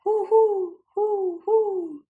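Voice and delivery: owl hoot. Acted. Storyteller voicing Owl for children, hooting. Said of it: "called Owl".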